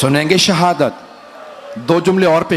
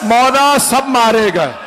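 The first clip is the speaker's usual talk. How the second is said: higher, slow grieving cry; tearful plea; 5 semitones higher than usual. Acted. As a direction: cry it through tears. Abbas grieving, tears in eyes.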